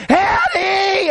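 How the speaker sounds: very high, long-held cry of a name; imitated holler. Frantic girl calling the man upstairs, imitated holler. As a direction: mimic her hollering at full stretch. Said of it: "I heard her holler".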